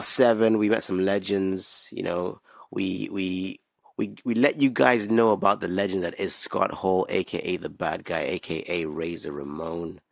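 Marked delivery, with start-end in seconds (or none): none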